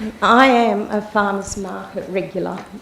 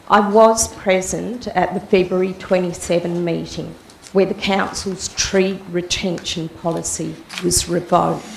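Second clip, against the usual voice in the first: ordinary talk, nothing marked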